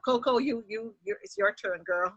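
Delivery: playful handoff. Real, not acted. Playfully handing over to Coco.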